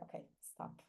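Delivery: hushed, breathy whisper to herself; a firm whispered halt; whispered stop. Retold or imitated. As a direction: whisper it like a sharp inner command. Self-talk, calling a halt inside.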